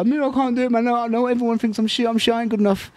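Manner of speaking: high-pitched protesting; retold panicky pleading with the coach; acted self-doubt. Fighter before his first title pleading with his coach, acted self-doubt.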